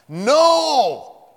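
high, very loud, drawn-out cry; emphatic shout. Real, not acted. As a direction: shout down every doubt. Climax of emphatic preaching point.

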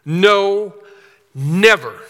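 "No" and "never" each loud, with a pause between; emphatic no never. Shown as a demonstration. Preacher demonstrating the Greek emphasis, loud no, never. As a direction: slam "No", then "never" falling. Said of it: "In Greek, a double negative is emphatic".

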